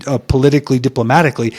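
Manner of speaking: ordinary talk, nothing marked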